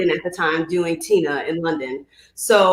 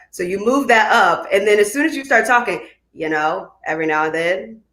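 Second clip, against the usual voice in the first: ordinary talk, nothing marked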